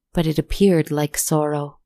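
ordinary delivery

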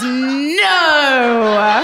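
drawn-out mock judgmental no; hammed-up disapproval. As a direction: imitate a withering put-down, playing to laughs.

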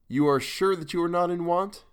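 gently mock her pretence of poverty; good-natured sarcasm. A narrator's reading. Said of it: "with a tinge of good-natured sarcasm".

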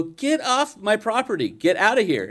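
high-pitched retold scolding; mock grumpy order. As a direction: order them away in put-on indignation.

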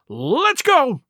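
high-pitched urge to flee; frightened gasp. A narrator's reading. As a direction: gasp it out, desperate to get away. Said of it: "gasped one".

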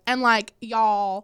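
slow, drawn-out Southern imitation; Southern accent. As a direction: stretch "y'all" like a Southerner. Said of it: "I love the Southern".

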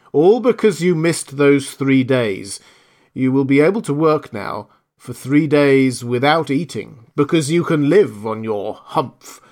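a genie ordering the camel off; commanding genie. Acted.

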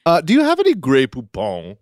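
mock-refined society voice; hammed-up socialite. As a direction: inquire grandly, as a socialite. Socialite character bit.

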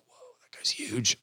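hushed, whispered amazement; awed whisper. Retold or imitated. Locals marveling at the big newcomer, awed whisper. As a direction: whisper it like an awestruck onlooker.

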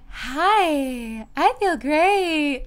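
high, slow, sugary forced cheer; fake cheer. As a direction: pretend everything is fine to the firemen. Fakes being fine to firemen.

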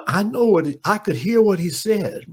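high, firm champ voice; Ali impression. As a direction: say it high and sure. Ali answers entourage.

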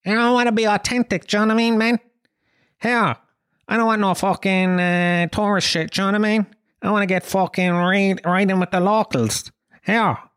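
high, put-on voice of a try-hard traveller; mocking friend's voice. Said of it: "he wanted to get authentic".

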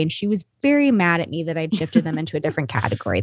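ordinary delivery

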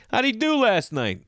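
hammed-up snooty member asking about the comedian; nosy club member. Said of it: "Like I'm a piece of property".

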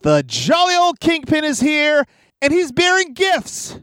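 high, upbeat podcast intro read; announcer voice. Acted. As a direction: sell the show like a host.

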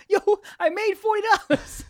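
gleeful. Retold or imitated. Retold gleeful reply.

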